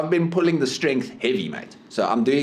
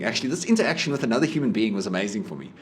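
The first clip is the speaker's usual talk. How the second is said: ordinary delivery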